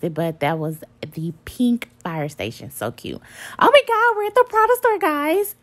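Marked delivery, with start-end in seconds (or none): 3.6-5.6 high, loud burst of excitement; excited. Real, not acted. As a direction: squeal the arrival to the viewers. Arriving at the Prada store.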